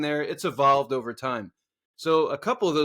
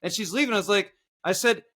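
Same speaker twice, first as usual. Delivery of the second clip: ordinary talk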